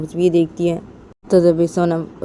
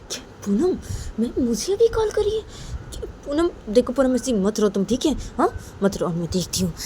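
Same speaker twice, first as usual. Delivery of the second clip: higher, lightly voiced surprised then soothing; surprised friend; 6 semitones higher than usual. Pooja gets Sonam's call, higher and lightly soothing. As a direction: react sharply, then calm her.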